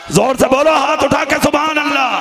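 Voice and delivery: loud burst urging a response; rallying call. Real, not acted. Preacher asking congregation to raise hands, rallying call. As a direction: rouse the crowd to answer. Calling crowd to respond loudly.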